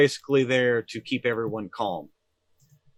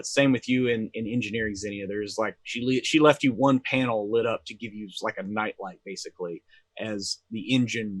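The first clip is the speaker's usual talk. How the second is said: ordinary talk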